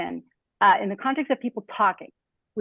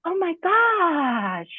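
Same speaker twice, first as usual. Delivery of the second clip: very slow, much higher, lengthened "Oh my gosh"; drawn-out exclamation; 9 semitones higher, much slower than usual. Shown as a demonstration. Teacher demos prosody, stretched high exclamation. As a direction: stretch it out like a gasp of amazement. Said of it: "those could be things like".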